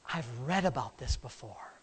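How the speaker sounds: gasp, then hushed low realization; acted sudden revelation. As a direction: play Matthew softly struck by a discovery. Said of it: "had a revelation".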